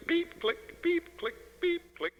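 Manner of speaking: high, breathy beep-click projector noise; toy-like beep and click sound effect; imitated projector beeps. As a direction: mimic a film strip projector ticking along. Film strip projector noises.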